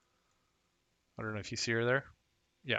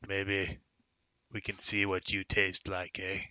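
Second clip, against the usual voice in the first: understated ogre voice; ogre threat; slower than usual. Acted. Ogre threatening to eat the man, understated menace. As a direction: lightly voice a hungry ogre toying with prey. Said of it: "And this, this ogre".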